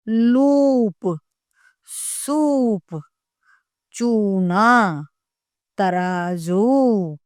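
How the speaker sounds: every word stretched slowly for learners to repeat; slow clear teaching. Shown as a demonstration. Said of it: "इसके शब्द होते हैं". (बच्चों को सिखाने के लिए धीरे धीरे बोलना)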